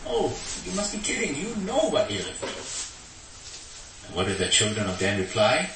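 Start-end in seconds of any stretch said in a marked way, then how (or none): 0.1-4.1 high, incredulous retort, slowing at the end; indignant Micah. Retold or imitated. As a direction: scoff at the robbers, much higher. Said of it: "And he says".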